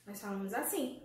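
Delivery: normal talking voice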